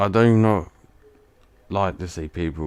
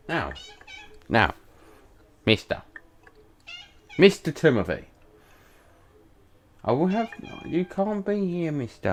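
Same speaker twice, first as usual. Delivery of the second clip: higher pet-talk scold; scolding the cat; 9 semitones higher than usual. Real, not acted. Shooing Tim the cat off, lightly raised pet-talk scold. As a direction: tell the cat off in a sing-song voice.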